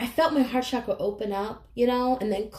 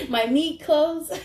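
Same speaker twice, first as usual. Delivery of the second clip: slow, louder, giggly; laughing; 4 semitones higher, 4 dB louder, slower than usual. Real, not acted. Reader joking to viewers about the body, laughing. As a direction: let the laugh carry the words. Jokey self-mocking phrase.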